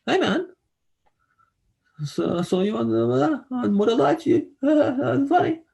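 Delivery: high, bright fan gushing from across the bog; eager fan voice. Retold or imitated. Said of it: "someone's like".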